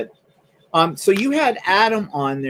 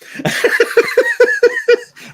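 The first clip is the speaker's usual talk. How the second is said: laughing; 9 dB louder than usual. Real, not acted. Laughing at own joke.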